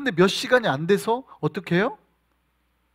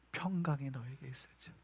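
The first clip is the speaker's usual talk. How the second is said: soft, low blessing; gentle comfort; 5 semitones lower, 15 dB quieter than usual. Acted. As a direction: soothe the frightened disciples. Jesus comforting frightened disciples. (두려운 제자들을 부드럽게 위로하는 목소리)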